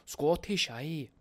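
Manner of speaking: badly faked Scottish accent, starting high. Host testing how Scottish he can sound, bad Scottish accent. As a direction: have a poor go at Scottish. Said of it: "Terrible at accents".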